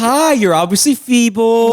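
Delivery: slow high patronizing voice; condescending elder talk. Retold or imitated. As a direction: talk down as if to a child. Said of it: "how I talked to".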